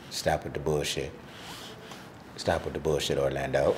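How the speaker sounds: hammed-up baby talking from the belly. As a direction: voice the unborn baby, low and hushed. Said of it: "That's what the baby said".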